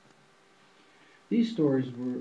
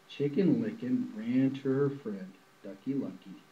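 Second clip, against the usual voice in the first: ordinary delivery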